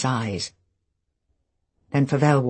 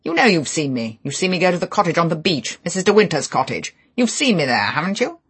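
higher, louder, fast accusation; angry accusing; 6 semitones higher, 6 dB louder than usual. Acted. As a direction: corner the witness hard.

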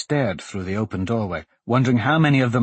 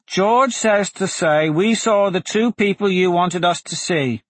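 put-on high, raised little boy's voice; small voice; 9 semitones higher, 4 dB louder than usual. A narrator's reading. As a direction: pipe up like a small boy reporting. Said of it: "a small voice said".

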